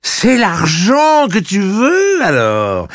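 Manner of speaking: loud, high, slow drawn-out taunt; enraged, jeering question; furious, with angry smile. A narrator's reading. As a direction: stretch the question out in rage. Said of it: "avec un sourire rageur".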